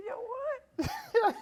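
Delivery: high, squeaky disbelief; hammed-up double take; high startled squeak; mock shock. Dad shocked at baby news.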